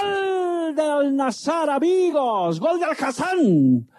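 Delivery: high, stretched goal call; breakneck goal commentary. Acted. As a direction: sing out the goal like radio commentary. Radio goal call play-by-play.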